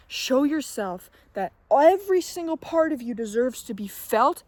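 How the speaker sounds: normal talking voice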